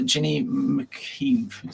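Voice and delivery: slow, stumbling attempt at "McKeeve"; unsure pronunciation. Real, not acted. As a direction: falter through "McKeeve", unsure how to say it. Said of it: "Sorry, I can't pronounce your name".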